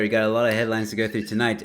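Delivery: usual speaking voice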